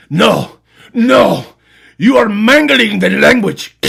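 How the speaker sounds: high, loud, slow indignant cry; outraged teacher. Retold or imitated. Teacher objecting to the pupil's Spanish, high loud slow. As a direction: drag out the outrage.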